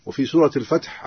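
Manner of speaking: normal talking voice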